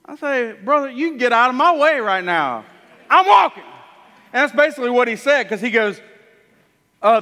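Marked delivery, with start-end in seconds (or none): 0.5-3.6 loud, high, triumphant defiance; joyful loud brush-off; defiant shout. Acted. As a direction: tell them to move, then shout I'm walking. Healed man defies the leaders.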